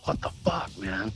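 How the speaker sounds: breathless disbelieving whisper; a shocked whisper after hearing of a death; stunned whisper. Retold or imitated. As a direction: barely voice the words, stunned by the news. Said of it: "I was just like".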